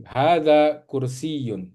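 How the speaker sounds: Hadza kursiyun slowly, yy doubled in kursiyun. Shown as a demonstration. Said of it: "ya-nya itu harus, eh, ditasjid".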